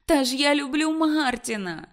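high, loud pleading; plaintive protest. Acted. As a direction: Ruth protests pitifully to mother. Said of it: "жалісно протестувала Рут". (жалібно протестуючи, високо й голосно)

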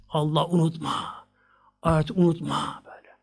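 hushed warning; hushed sermon warning; whisper. Real, not acted. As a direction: whisper it like a grave caution. Warning against forgetfulness.

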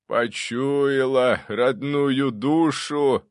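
slow, drawn-out ghostly voice; grand, very slow character voice; booming ancestor. A narrator's reading. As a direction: stretch it out like a pleased old ghost. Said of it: "басом сказал он".

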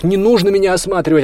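high, loud, cutting refusal; curt refusal. A narrator's reading. Olya refusing the officer's examination, cutting him off. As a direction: cut them off indignantly. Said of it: "отрезала Оля".

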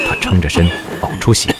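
plain reading voice

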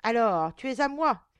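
loud monster's claim; triumphant cry. A narrator's reading. Monster revealing itself to its prey, triumphant shout. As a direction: burst out loudly, claiming your prey. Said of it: "s'écria une affreuse Letiche".

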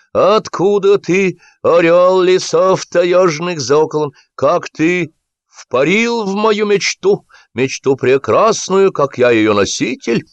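high, slow mock-lofty declamation; sarcastic director in full oratorical flight; theatrical ranting. A narrator's reading. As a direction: declaim grandly, stretching every word. Director rants at his actor.

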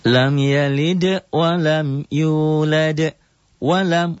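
slow, high melodic tartil chant; Quran recitation. Shown as a demonstration. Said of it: "teacher recites Quran verse". (lantunan tartil pelan)

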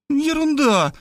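put-on high-pitched girlish brush-off; dismissive young woman. A narrator's reading. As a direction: wave it away in a high voice. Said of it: "отмахнулась она". (высокий девичий голос, пренебрежительно)

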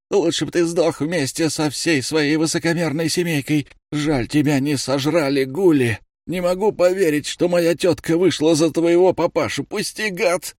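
high, strained angry cousin voice. Acted. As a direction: spit it out in pure spite. Разъярённый кузен ругается лёжа. (злобный, высокий, надрывный голос)

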